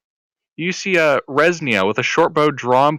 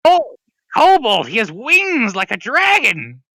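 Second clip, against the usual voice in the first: high, loud, excited creature voice; kobold voice; 11 semitones higher, 5 dB louder than usual. Acted. Kobold describes Trex.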